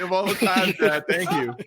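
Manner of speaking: high, exasperated sigh at dad. Real, not acted. Son groaning about his dad's timing, high and exasperated.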